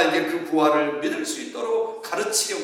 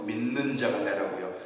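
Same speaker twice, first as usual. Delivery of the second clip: quiet emphasis; 7 semitones lower, 7 dB quieter than usual. Acted. Pastor answering his own question, low and soft.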